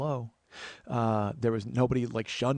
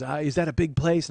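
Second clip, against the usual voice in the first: lightly voiced neighbor lady, higher and louder; curious woman's voice, pitched up; 5 semitones higher, 6 dB louder than usual. Neighbor asking about the studio, raised character voice. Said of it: "She goes".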